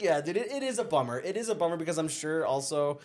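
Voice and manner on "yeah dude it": normal talking voice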